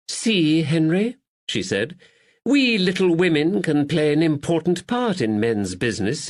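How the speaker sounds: high, cooing affectionate lady. Acted. Lady Caroline coos at her lover, affectionate. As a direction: coo it at her darling.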